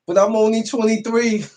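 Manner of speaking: high, laughing protest about his age. Real, not acted. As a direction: squeal it out through a laugh.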